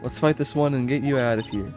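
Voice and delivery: ordinary delivery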